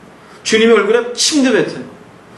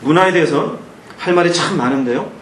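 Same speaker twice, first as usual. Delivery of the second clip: usual speaking voice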